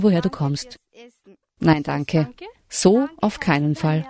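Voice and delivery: ordinary talk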